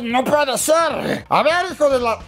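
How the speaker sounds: enraged nasal mascot demo. Shown as a demonstration. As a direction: push it from the throat like he's losing it. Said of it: "le meto más garganta".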